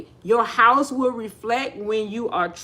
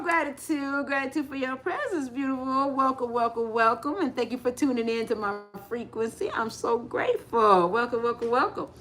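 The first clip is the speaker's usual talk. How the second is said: soft, tender welcome; warm gratitude; 8 dB quieter than usual. Real, not acted. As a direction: drop to a hushed, grateful thank-you. Thanks the viewer softly.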